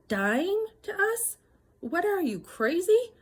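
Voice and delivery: rising disbelief at his nerve; incredulous outburst. Retold or imitated. Wife confronting husband over money, high incredulous outburst. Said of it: "I said".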